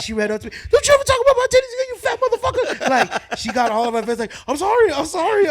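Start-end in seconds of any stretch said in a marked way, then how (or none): 0.7-2.9 high, loud, fast mock screaming; hammed-up enraged yelling, pitched way up; imitating furious friend. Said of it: "she got so mad". 4.5-5.5 loud, high mock pleading; hammed-up frantic apologizing; panicked apology. Pleading as she attacks him.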